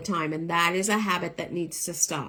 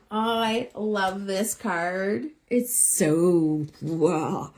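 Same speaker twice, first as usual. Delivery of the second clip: drawn-out delight; much slower than usual. Real, not acted.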